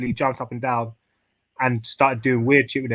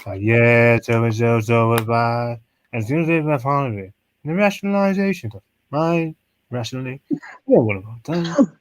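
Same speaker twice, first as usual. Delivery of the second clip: low, droning monotone imitation; lightly mimicked hypnotic lecturer; Rob; 4 semitones lower than usual. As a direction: imitate a fancy-voiced speaker, low and meditative. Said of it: "Rob's voice".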